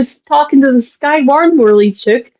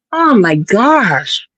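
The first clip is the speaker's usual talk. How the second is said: slow, drawn-out disbelief; retold exasperated groan; much slower than usual. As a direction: stretch every word in dismay.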